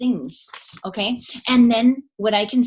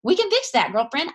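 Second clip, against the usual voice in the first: high, bright upbeat reassurance; 11 semitones higher than usual. Retold or imitated. Coach cheering up a frustrated team member, high and upbeat. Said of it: "I'm like".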